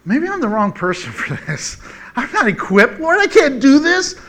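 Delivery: hammed-up doubt, building to a high whine; self-doubting. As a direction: plead your way out of the job. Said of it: "all of a sudden I'm like".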